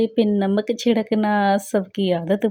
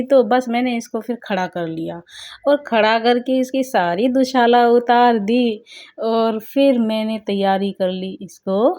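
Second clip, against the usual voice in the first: normal talking voice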